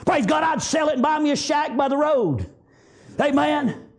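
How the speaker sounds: ordinary delivery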